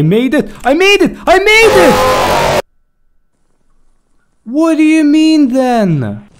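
high triumphant shout; relieved shouting. Real, not acted. Escapes the monster, relieved.

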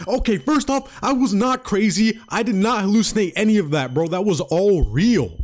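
high, emphatic objection; indignant protest. Acted. Ranger answers being called crazy, indignant protest. Acts protest to Mitch's doubt.

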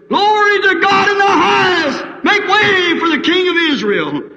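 high, loud shouted praise; joyful shout. Retold or imitated. The crowd hails the King of Israel, shouting high and loud. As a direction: proclaim it over the whole crowd.

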